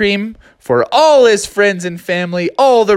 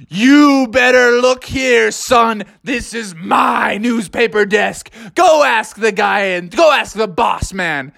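slow, higher, shouted warning; hammed-up threat; 6 semitones higher, slower than usual. Froggy warning the rival about the desk, slow mock shout.

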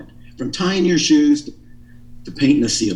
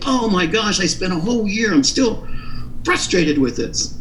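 exasperated client; 6 semitones higher than usual. Retold or imitated. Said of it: "you're gonna say".

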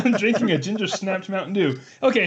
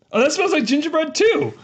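surprised; 10 semitones higher than usual. Real, not acted. Surprised at the smell.

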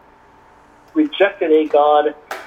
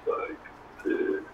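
cursed slow-jawed voice; 16 dB quieter than usual. Shown as a demonstration. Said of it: "he talks very slow".

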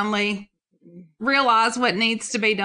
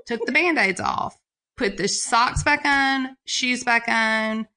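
ordinary talk, nothing marked

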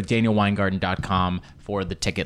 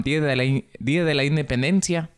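attempted Mexican Spanish accent; more Mexican accent; 3 semitones higher, faster than usual. Shown as a demonstration. Host corrects his accent for listeners, more Mexican Spanish. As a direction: pull the Spanish back toward Mexican. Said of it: "Un poco más mexicano".

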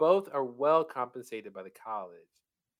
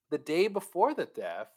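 ordinary talk

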